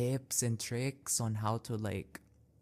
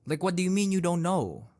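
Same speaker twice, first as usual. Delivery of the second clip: louder, higher disbelieving retort; exasperated; 5 semitones higher, 5 dB louder, faster than usual. Real, not acted. Vlogger retorting at the rude lady, exasperated and louder. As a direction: challenge her in disbelief.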